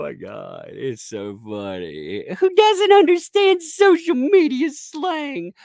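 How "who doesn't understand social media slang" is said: high, loud, drawn-out taunt; slow it down and jeer way up high; exaggerated high-pitched mockery; mocking voice. Mocking critics in a put-on voice.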